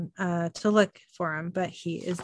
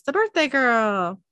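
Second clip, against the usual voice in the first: drawn-out, higher and louder mock cheer; party call-out; 5 semitones higher, 5 dB louder than usual. Man at a birthday party hailing the guest, slow mild cheer. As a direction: lightly hail the guest of honor. Partygoer calls out in retold scene.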